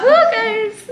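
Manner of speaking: high-pitched whoop; celebrating. Real, not acted. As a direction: cheer the right guess, voice jumping up. Cheers a correct guess.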